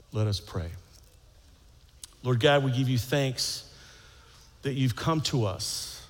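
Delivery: prayer. Acted. Leads a prayer.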